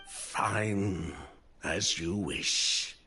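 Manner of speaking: slow, low concession; resigned. Acted. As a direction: give in slowly, voice sinking. Reluctantly agrees to talk.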